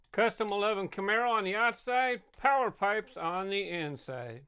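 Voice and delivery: loud race-calling announcer. Acted. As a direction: call the race like a track announcer. Race call announcing heats.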